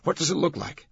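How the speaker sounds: high-pitched, insistent question; urging. A narrator's reading. Langdon asks about the sculpture, urging. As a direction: press eagerly for a description. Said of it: "Langdon urged".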